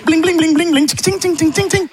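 high, sung bling and tching noises; a silly sound effect of something filling up; imitated pouring sounds. Shown as a demonstration. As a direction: make the milk-pouring noises with your mouth. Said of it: "faire des bruitages".

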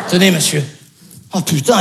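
usual speaking voice

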